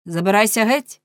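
loud, brusque dismissal; shouting. A narrator's reading. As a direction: order him away loudly. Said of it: "крикнув граф". (гучний владний окрик)

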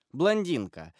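ordinary delivery

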